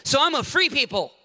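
hammed-up boasting of church membership; mock proud excuse. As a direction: sneer out the wrong answer. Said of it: "You better not say".

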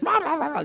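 high nonsense babble; high "rah-rah" jabber; imitated kid chatter. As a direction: mimic a child talking up a storm. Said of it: "saying stuff. I don't remember what".

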